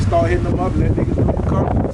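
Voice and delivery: usual speaking voice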